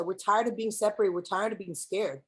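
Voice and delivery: ordinary talk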